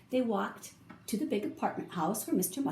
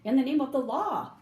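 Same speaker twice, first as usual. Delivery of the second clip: higher, louder police order; commanding shout; 5 semitones higher, 5 dB louder than usual. A narrator's reading. Policeman to the fleeing animals, shouting an order. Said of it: "shouted the policeman".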